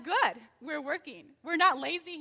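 mock smug boast; smug self-praise. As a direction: brag like a proud church member. Congregation's smug imagined reply.

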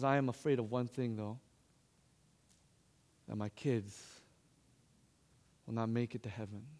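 low, very soft retold worry; hushed confession. Grandmother confiding fear for her kids, hushed and low. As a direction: let the voice sink low and quiet.

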